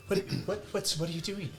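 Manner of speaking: high, startled dwarf woman asking; a flustered high little dwarf wife; dwarf woman cuts in, high and startled. Acted. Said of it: "the dwarven woman".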